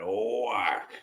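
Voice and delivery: long drawn-out whack sound effect; drawn-out mimicked wallop; imitated punch. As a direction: stretch the word out like a big blow landing.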